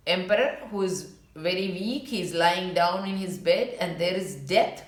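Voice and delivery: ordinary delivery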